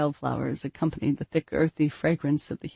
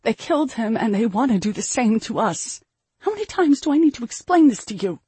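raised, louder rebuke building in pitch; angry exasperation; 6 semitones higher, 4 dB louder than usual. A narrator's reading. Mother angrily repeats father is dead.

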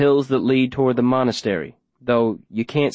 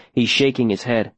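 ordinary delivery, nothing marked

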